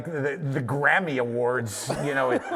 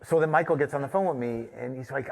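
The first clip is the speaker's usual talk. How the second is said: ordinary talk, nothing marked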